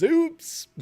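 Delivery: a high mock squeak reading chat; squeaky oops. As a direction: pop it out high like a cartoon. Reads a chat message in a squeak.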